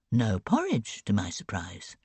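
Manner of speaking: plain reading voice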